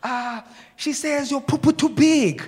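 high, slow, loud imitation; neighbor's broken English. Neighbor translating for the landlord, imitated broken English. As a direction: mimic a halting translator, building to the punchline. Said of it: "can speak a little bit of English".

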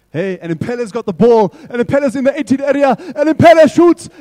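hammed-up commentator yelling as the striker shoots; soccer commentary. Kids hear a play-by-play shouted to the goal.

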